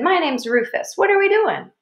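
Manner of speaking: put-on bright, eager, high-pitched kid voice; eager new kid, question leaping up; big-smiling country kid. A narrator's reading. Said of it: "smiled at me real big".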